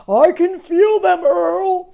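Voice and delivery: a hammed-up sailor's shout. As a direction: yell back through the storm. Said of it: "shouted Buddy".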